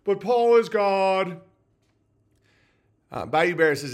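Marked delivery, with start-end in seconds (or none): 0.0-1.4 higher, slow, put-on indignant whine. Mocking churchgoers who defend Paul, in a slow, higher put-on whine. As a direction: sneer out their pious outrage, slowly. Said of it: "They're like".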